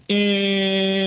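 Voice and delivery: long drawn-out name, pitched high; announcer-style introduction. Pretend. Host introducing his co-host on the podcast, drawn-out announcer call. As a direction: hold the first syllable like a ring announcer.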